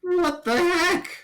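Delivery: high, loud, drawn-out disbelief; baffled outburst. Acted. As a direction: squeal it slowly in comic bafflement.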